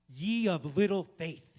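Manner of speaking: slow, very loud rebuke; Jesus chiding. Retold or imitated. Jesus scolding a sinking Peter, slow and loud. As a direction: draw out a gentle scolding. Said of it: "And Jesus is like".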